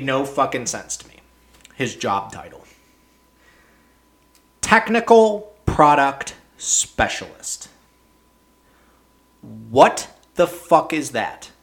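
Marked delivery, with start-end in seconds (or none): 4.6-7.7 slow, sneering read of a silly job title; scornfully spelled out, word by word; mocking title. Real, not acted. 9.4-11.5 slow, baffled question; incredulous at the nonsense; drawn-out what-the-hell; exasperated disbelief. Real, not acted. Exasperated rant about title.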